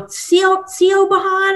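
"Sio Bahan" sounded out as spelt, high-pitched; hesitant mispronunciation. Retold or imitated. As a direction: stumble on "Sio-", then guess "Bahan". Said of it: "they'll always be like".

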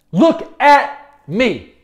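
slow, drawn-out show-off; mock boasting. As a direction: flaunt it, one slow word at a time. Mimics social media showing off.

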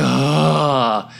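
drawn-out sigh of blissful relief; "aah" of relief, let all the way out; long relieved groan. Retold or imitated. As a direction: act out a huge release with a groaning exhale. Acts out relief of peeing.

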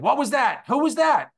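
jolted, demanding to know who cut in; startled outburst. Retold or imitated. Boss hears mother cut in.

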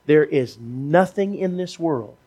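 preacher urging listeners forward to be saved; fervent preaching. Real, not acted. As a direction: drive the altar call home with force. Altar call at full force.

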